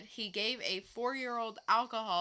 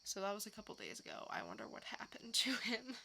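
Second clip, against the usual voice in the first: usual speaking voice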